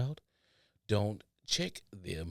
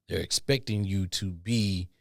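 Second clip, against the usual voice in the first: usual speaking voice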